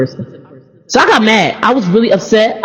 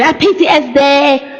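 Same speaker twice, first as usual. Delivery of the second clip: a high, slow mocking whine; mock complainer; 6 semitones higher, slower than usual. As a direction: lead the crowd in a parody whine. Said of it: "Everybody say".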